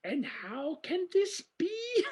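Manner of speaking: high, slow, drawn-out character voice; Alia. Retold or imitated. Host quoting the movie's closing question, hammed-up high voice. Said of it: "And Alia says".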